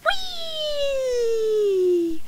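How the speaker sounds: high, drawn-out whee; gleeful whee. A narrator's reading. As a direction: slide down the word. Sliding down the hill.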